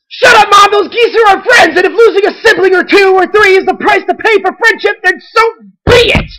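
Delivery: very loud, high, hysterical yelling; screaming tantrum. A narrator's reading. Duckling defending the geese to Mom, screaming. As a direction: scream back like a child in a meltdown.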